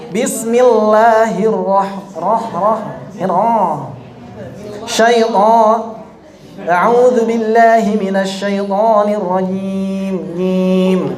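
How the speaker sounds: mock reciter pushing the sound through the nose; hammed-up nasal "Bismillahirrahmanirrahim"; imitating nasal recitation. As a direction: parody a wrong recitation. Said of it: "ada yang baca begitu".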